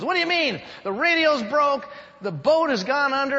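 high, loud panicky yelp; panicked castaway. Acted. Stranded man yelling at his calm friend in panic.

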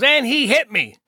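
retold outraged accusation; going off. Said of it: "my grandma's going off".

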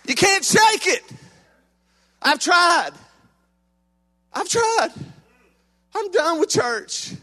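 high, put-upon complaint; mock whiny churchgoer. Preacher voicing a fed-up churchgoer, high and whiny. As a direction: whine like a fed-up member.

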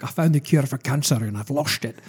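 slurred-s movie-star impression with a Scottish burr; Sean Connery. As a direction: do an offhand impression of the actor, s turning to sh. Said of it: "commend your Sean Connery there".

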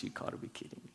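breathy whispered disbelief, barely voiced. Mentor stunned on meeting the man he jailed, whispering. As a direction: mutter it under your breath in shock. Mentor sees old victim.

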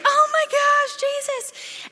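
high, loud starstruck squeal; fangirling squeal. Retold or imitated. Preacher mimicking girls fangirling over Jesus, high squeal. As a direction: squeal like a swooning fan. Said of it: "fangirling over him".

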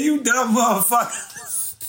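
normal talking voice

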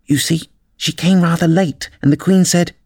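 high, small confiding voice; anxious high-pitched rabbit voice; nervous rabbit. A narrator's reading. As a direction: tell the story as a timid rabbit. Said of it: "the Rabbit whispered".

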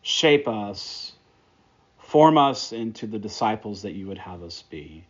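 quiet, hushed prayer voice. Acted. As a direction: pray it gently, softening as it goes. Closing prayer before the sermon.

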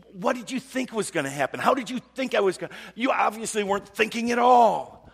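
high, loud acted rant; really ticked off. Man praying drifts into scolding somebody, ticked off. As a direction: build an exasperated tirade at full voice. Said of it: "I'm talking really ticked off".

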